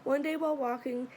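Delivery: plain reading voice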